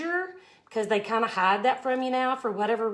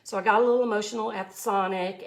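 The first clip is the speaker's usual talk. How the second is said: ordinary talk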